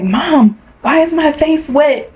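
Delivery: put-on high, slow little-kid voice; lightly voiced small child; child asking mom. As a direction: play your confused toddler self.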